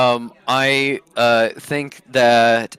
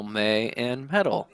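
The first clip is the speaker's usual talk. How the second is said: ordinary talk, nothing marked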